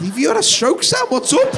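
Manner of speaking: high, loud worried question; startled friend checking in; alarmed concern. Retold or imitated.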